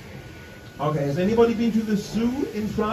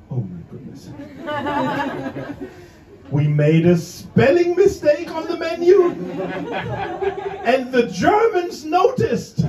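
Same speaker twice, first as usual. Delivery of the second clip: hammed-up horrified realization; mock panicked waitress; 9 semitones higher, 4 dB louder than usual. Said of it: "the English waitress thought like".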